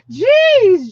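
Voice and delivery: high, loud shocked gasp of a word; shocked jeez. Real, not acted. Reacting to a viewer's guess, high loud shock.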